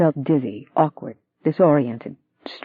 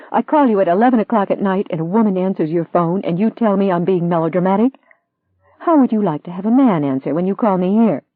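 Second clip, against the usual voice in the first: angry; 6 semitones higher, 3 dB louder than usual. Acted. Furious wife confronting unfaithful fiancé.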